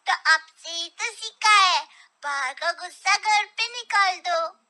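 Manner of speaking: put-on a kid throwing dad's words back; cheeky child. Son answering father back, cheeky child voice.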